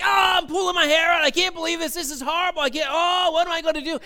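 high, overdramatic panicked wailing; hammed-up stress freak-out. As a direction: lose it in mock despair, starting loud. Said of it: "first reaction to stress".

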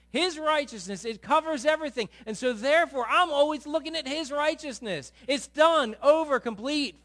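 loud, rising pulpit preaching; preaching at full force. Acted. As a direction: drive it home to the congregation.

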